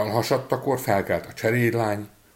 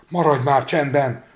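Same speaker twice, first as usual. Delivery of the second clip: wolf's scolding; 6 semitones higher, 7 dB louder than usual. A narrator's reading. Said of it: "szólt rá a farkas".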